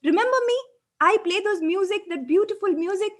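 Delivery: high, loud desperate shouting; screaming. A narrator's reading. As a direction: scream to be noticed over the crowd. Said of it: "And Ramu began screaming".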